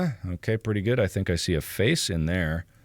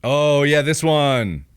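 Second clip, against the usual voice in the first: loud, raised burst of approval; sudden loud, high enthusiasm; pleased recognition; 6 semitones higher, 8 dB louder, much slower than usual. Real, not acted. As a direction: exclaim at spotting a favourite. Spots a photo he likes.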